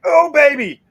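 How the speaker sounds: high, loud delighted yelp; thrilled shout of joy; excited whoop. Retold or imitated. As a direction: whoop it out like you just hooked a monster. Buddy celebrates catching fish.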